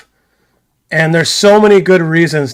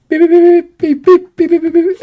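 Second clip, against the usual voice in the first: high squeaky beep beep beep, much higher; radio beeps; 12 semitones higher than usual. Retold or imitated. Host mimicking radio beeps to his co-host, high chirpy beeps. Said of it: "I'm gonna be like".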